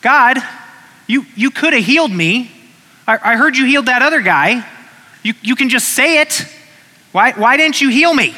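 high, loud, stammering angry protest; angry complaint. Retold or imitated. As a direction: accuse God, voice climbing and sputtering. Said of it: "You'd be angry".

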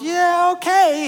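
high, amused yeah okay; indulgent dad. Retold or imitated. Dad humouring his daughter at the pool, high and amused. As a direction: humour her like a good dad.

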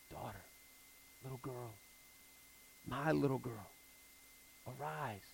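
hushed loving command; tender soft call. Acted. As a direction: wake a sleeping child softly. Jesus wakes the dead girl.

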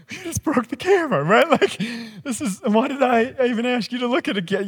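put-on high, incredulous squeak at the boy; exasperated disbelief. Pastor to the boy, high shocked disbelief. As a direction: act stunned that the camera just broke. Retelling shock at broken camera.

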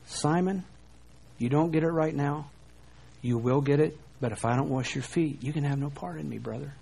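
soft, fading to a near hush by brother; quiet, tender reassurance to a friend; gentle correction. Retold or imitated. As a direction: tell him kindly, winding down to a murmur. Jesus gently corrects Peter.

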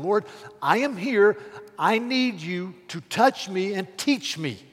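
loud, high calling prayer; fervent prayer. Pretend. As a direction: cry out to the Lord. Preacher voicing his prayer.

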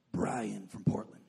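low, slow laid-back Portland dude; Portland guy voice. Retold or imitated. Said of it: "'cause that's how we talk".

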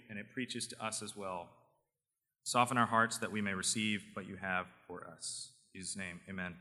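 soft, hushed prayer; quiet prayer. Real, not acted. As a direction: pray gently and quietly. Pastor leads closing prayer.

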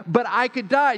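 high, pleading husband voice; mock whiny husband. Husband pleading with his wife, high and whiny. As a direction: whine it like a scared groom.

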